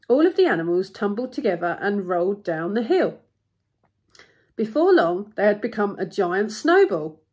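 lively storybook narrator; story narration. A narrator's reading. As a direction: tell it with punched key words. Story narration read aloud.

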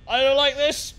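normal talking voice

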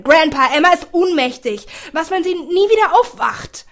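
laut, höher und aufgewühlt; in Panik den Großvater anflehen; panisches Flehen. A narrator's reading. Panicked boy pleads with grandfather. (Jack fleht den Großvater am Telefon an, laut und panisch)

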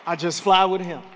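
rising to a shouted climax; preacher lifting the church about Jesus, building to a shout; preaching at full force. Acted. Bring it home, loud and high.

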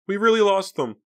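very high, loud exclamation; excited cry. A narrator's reading. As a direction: cry it out in thrilled relief. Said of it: "Pam cried".

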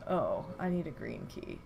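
usual speaking voice

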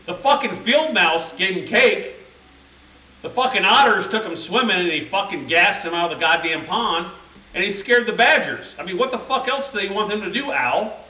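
fed up, demanding answers from Al; angry squirrel at a group meeting; indignant squirrel rant. Pretend. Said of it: "what I'd say if I was a squirrel".

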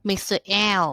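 ordinary talk, nothing marked

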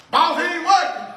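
hammed-up snitching holler; Eddie Murphy bit. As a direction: yell it out to the boss like a snitch. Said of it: "What's that move with Eddie Murphy".

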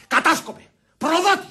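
rising, high-pitched accusation; angry accusation. Acted. Denouncing him as spy and traitor, accusing. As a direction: hurl the names as insults.

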